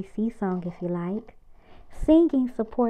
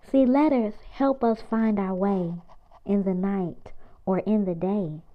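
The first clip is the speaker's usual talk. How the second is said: ordinary delivery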